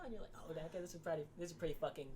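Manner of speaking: usual speaking voice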